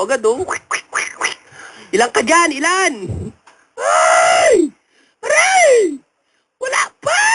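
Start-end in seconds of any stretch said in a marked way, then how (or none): 1.9-2.9 high, demanding mock questioning; interrogating healer. Healer questions the possessed medium, mock stern demand. 3.8-7.2 very high, tearful squeal; hammed-up agonised pleading. Whipped medium begs healer to stop, high mock crying.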